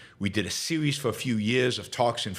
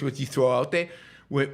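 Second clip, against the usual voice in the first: ordinary delivery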